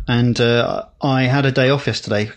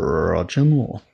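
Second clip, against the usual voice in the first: low, slow, drawn-out film-star voice; hammed-up actor introducing himself; Roger Moore; 4 semitones lower, slower than usual. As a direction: roll the R, stretch it out grandly. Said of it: "worst Roger Moore impression ever".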